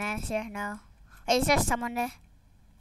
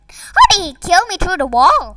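loud, higher indignant cry; outraged shout; 7 semitones higher, 13 dB louder than usual. Real, not acted. Gamer shot through a wall, loud outraged shout. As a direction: protest at the screen.

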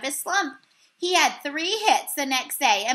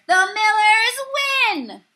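high, loud, slow announcement; umpire's shout; 8 semitones higher, 6 dB louder, slower than usual. Acted. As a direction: proclaim the win. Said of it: "shouted the umpire".

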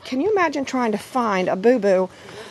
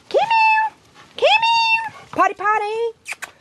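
squeaky falsetto calling to puppies; high potty call; slower than usual. Real, not acted. Owner calling puppies to come potty, high falsetto. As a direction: pitch it way up, luring the pups. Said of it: "do the high note of my potty song".

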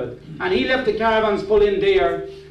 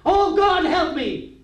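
much higher-pitched cry for help; mock desperate plea; 9 semitones higher, slower than usual. Driver in trouble suddenly remembers God, mock desperate plea. Acting out a panicked prayer.

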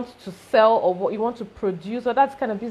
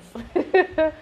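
brief amused chuckle; a quick laugh at the joke; short laugh; 6 semitones higher than usual. Real, not acted.

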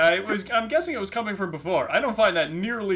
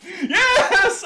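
laughing yes; 13 semitones higher, 7 dB louder than usual. Real, not acted. Laughs at own success.

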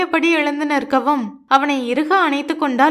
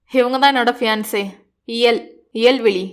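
firm, emphatic; 4 semitones lower than usual. A narrator's reading. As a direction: lower hero voice, firmly introducing her. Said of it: "என்றான் அழுத்தமாக".

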